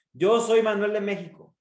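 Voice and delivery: "Yo soy Manuel de México" pushed high and loud throughout; stress on every word. Shown as a demonstration. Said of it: "sin necesidad de, de todo".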